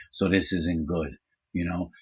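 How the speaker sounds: ordinary delivery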